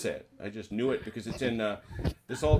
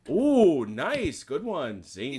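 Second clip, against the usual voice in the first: excited; 6 semitones higher, 9 dB louder, much slower than usual. Real, not acted. Reacting to a good joke.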